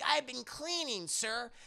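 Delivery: put-on high, drawn-out kid voice; earnest little girl. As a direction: answer like a dutiful child. Child answers the old man.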